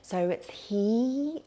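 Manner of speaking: slow, drawn-out "he" sound. Shown as a demonstration. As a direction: stretch "he" long and high. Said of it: "we linger on the he sound".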